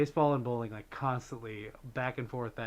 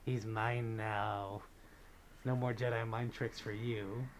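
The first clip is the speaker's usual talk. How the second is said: very slow, low, quiet gloat; slow villain gloat; 3 semitones lower, 5 dB quieter, slower than usual. Pretend. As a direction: drag it out like a smug Sith. Playing the Sith villain.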